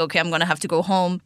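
ordinary talk, nothing marked

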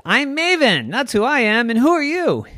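put-on high, loud little-girl comeback; indignant retort. A narrator's reading. Maven challenged by the bear, high indignant retort. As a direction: answer back high and forceful.

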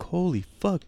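high-pitched, awed reaction; mock astonishment, pitch jumping up; retold amazement. Retells his amazement at the backyard.

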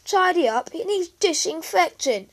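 high, loud scornful outburst; loud, high-pitched indignation; disgusted. Acted. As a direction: exclaim it in sisterly disgust. Sister disgusted by room.

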